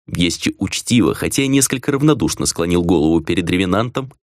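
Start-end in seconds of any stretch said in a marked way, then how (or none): none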